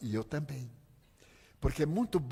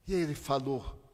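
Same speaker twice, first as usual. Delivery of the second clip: normal talking voice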